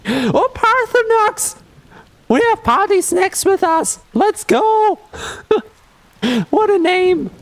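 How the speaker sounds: very high, gushing delight; greeting a beloved game pet with high-pitched glee; excited squeal. Real, not acted. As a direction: squeal with joy at a favourite.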